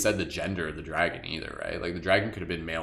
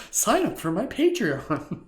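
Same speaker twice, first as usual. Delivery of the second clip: high, slow, laughing fake promo voice; mock sales pitch; 13 semitones higher, 3 dB louder, slower than usual. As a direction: ham up a cheesy plug while laughing. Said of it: "the part where I'm like".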